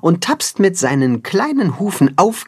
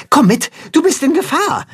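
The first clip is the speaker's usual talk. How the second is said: urgent; 13 semitones higher than usual. Acted. Urgent warning to friend in danger.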